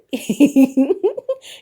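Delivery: high, loud burst of laughter. Real, not acted. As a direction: crack up loudly. Laughing about the suits.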